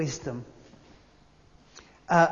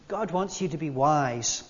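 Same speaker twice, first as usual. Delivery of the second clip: ordinary delivery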